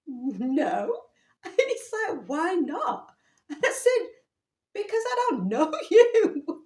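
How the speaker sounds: high and loud, laughing through the no; giggling brush-off; laughing refusal. Retold or imitated. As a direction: refuse him while cracking up. Retold refusal of pushy guy.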